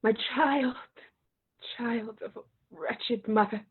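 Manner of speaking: put-on My child said soft and falling; low sorrowful My child; choked murmur. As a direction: let My child sink into grief. Mother about to tell death sentence.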